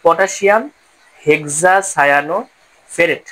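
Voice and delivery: হেক্সাসায়ানোফেরেট stretched out very slowly, syllable by syllable; a teacher drawing out হেক্সাসায়ানোফেরেট as a model name; slow careful naming. Shown as a demonstration. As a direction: spell হেক্সাসায়ানোফেরেট out slowly for the class. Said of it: "তাহলে কি বলবো".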